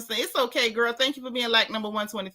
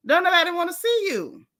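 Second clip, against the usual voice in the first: sassy dismissal; 7 semitones higher than usual. Retold or imitated. Repeats the line higher.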